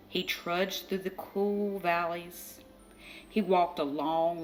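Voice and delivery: ordinary delivery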